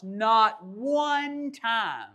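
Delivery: stretched very slow and high; preacher dragging out not one time; drawn out. Acted. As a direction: lean hard on each word, slowly. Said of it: "preacher drawing out the point".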